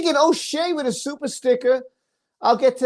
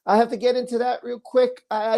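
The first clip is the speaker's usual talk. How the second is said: normal talking voice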